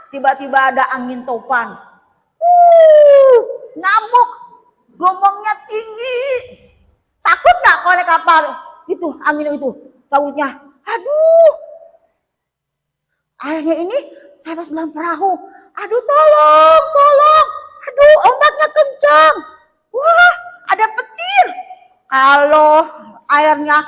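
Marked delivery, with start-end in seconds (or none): 2.4-4.3 loud high whoosh for the raging wind; mock storm gust, then a high raging word; howling storm. Storm sound in story. 15.8-21.5 hammed-up high fearful calls of aduh and tolong; panicked cry for help. Disciple panics in the storm.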